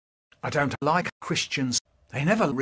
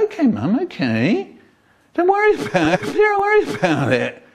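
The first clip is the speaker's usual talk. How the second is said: much higher, fast mock-innocent kids; kids placating; 12 semitones higher, 5 dB louder than usual. Kids reassuring mum in the car, high and falsely sweet. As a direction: chirp it sweetly to fob mum off. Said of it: "So we go".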